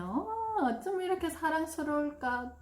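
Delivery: high doting gush; gushing praise. Shown as a demonstration. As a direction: dote over the child. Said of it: "이런 말은요". (아이에게 감탄하며 다정하게)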